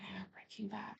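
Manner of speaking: breathy unvoiced whisper. Real, not acted. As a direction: whisper it like a secret. Whispered aside about Nana.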